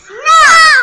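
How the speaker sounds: high loud shout of no. Acted. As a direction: yell No! at the monster. Children shout the title's no.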